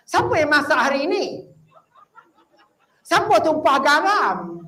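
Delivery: loud fault-finding husband at dinner; complaining husband. Retold or imitated. Husband complaining about wife's cooking.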